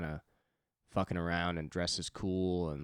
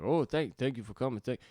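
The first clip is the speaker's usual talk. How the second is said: higher, louder voice of a grateful musician; imitating polite thanks; 7 semitones higher, 5 dB louder than usual. Musician thanking guests who barged into green room, imitated polite thanks. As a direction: lightly act out flustered, courteous thanks. Musician greeting the visitors, acted out.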